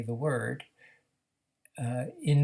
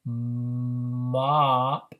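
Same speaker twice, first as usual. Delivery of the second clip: MOP stretched as one unbroken syllable; continuous and slow; 5 dB louder than usual. Shown as a demonstration. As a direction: hum the m straight into a long ah in MOP. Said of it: "say the sounds all together but slowly".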